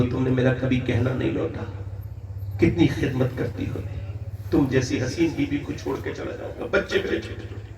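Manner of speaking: low, fading voice of a dying man; acted tearful husband. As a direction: grieve softly, imitating a weeping husband. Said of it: "रोने लगा, दहाड़े मार के". (रोते पति की दबी आवाज़ की नकल)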